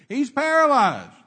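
slow loud call relayed through crowd; passed-along yell. Retold or imitated. People relaying the word along, slow loud call. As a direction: shout it down the line.

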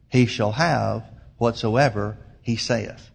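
low, very slow scripture reading, spaced out; slow, deliberate reading. Real, not acted. Preacher drives the verse home to the congregation, slow and deliberate. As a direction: let each phrase land with a pause. Closes the verse slowly, repeats last clause.

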